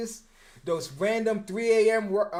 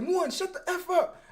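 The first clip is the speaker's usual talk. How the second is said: pissed-off coach; 12 semitones higher than usual. Retold or imitated. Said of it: "And then he got pissed".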